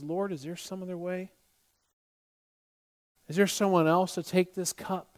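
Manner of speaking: hushed, imagined plea to God; quiet prayer. Retold or imitated. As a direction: pray it softly, pausing between the questions. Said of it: "in that prayer".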